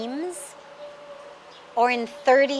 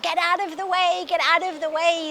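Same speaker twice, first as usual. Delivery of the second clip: higher urgent warning yell; mahout yelling; 5 semitones higher than usual. Retold or imitated. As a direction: yell to clear the way of the elephant. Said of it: "he's yelling".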